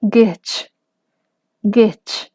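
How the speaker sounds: gech in plain modal voice. Shown as a demonstration. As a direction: say gech smoothly, no glottal catch. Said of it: "si lo pongo sin comilla".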